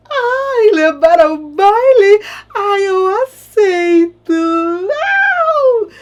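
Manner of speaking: high, loud, slow squeal of joy; hammed-up overjoyed shriek; squealing delight. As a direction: squeal like a giddy bride-to-be. Baratinha accepts the proposal.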